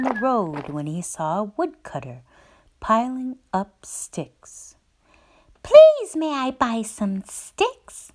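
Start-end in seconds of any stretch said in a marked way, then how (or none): 5.6-8.1 polite little pig. A narrator's reading. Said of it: "he asked politely".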